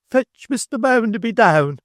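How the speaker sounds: high, loud summoning cry; excited cry. A narrator's reading. Mrs Sparsit calling for Bounderby, loud excited cry. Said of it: "cried Missus Sparsit".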